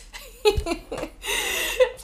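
bright high laugh. Real, not acted. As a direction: burst out laughing at the slip. Laughs at own slip.

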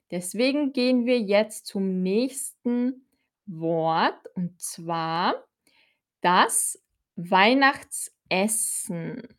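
ordinary delivery

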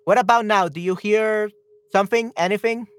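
ordinary talk

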